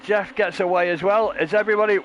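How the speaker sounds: breathless excited commentator at the green flag; excited play-by-play. Acted. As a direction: call the start like the crowd is on its feet. Live race-start commentary.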